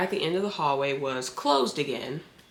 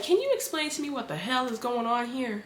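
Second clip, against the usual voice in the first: high-pitched, exasperated retold question; exasperated demand; 7 semitones higher than usual. Woman demanding explanation from Brad, exasperated high voice. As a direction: pitch it up in disbelief.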